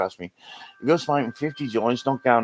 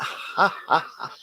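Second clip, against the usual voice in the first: short scornful ha ha ha, half laughed; forced scoffing laugh at the law; mocking laugh; 6 semitones higher, slower than usual. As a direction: laugh it off as a joke. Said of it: "I laugh".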